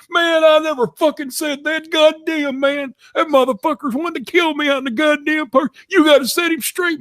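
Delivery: very high, panicked begging; hammed-up terrified begging. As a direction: squeal for rescue. Tim begging Kenny for help.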